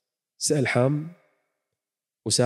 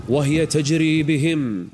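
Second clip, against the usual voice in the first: drawn-out, louder recited verse; slow Quran recitation; 4 semitones higher, 4 dB louder, much slower than usual. Acted. Preacher recites the ship sailing through waves, slow. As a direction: stretch each word as in recitation.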